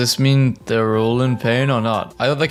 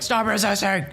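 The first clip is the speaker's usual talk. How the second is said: higher, barked police order; mock cop yell; 7 semitones higher than usual. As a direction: play a cop ordering someone down. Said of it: "American pigs be like".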